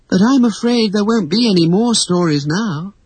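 put-on high, regretful girl's voice; wistful, mildly sad; sighing. A narrator's reading. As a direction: break it with a sigh of regret. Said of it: "sighed Wendy".

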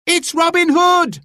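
very high excited shout; crowd cry. A narrator's reading. A voice in the crowd recognizes Robin, excited shout. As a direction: gasp out his name to everyone. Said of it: "cried a voice in the crowd".

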